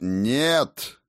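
loud, barked refusal. A narrator's reading. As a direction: bark it like a blow. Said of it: "рявкнул хозяин".

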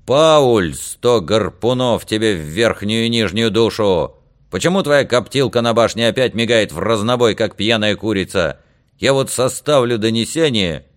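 comic seafaring tirade; blustering coast guard chief, scolding. Acted. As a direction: bellow the name, then scold on.

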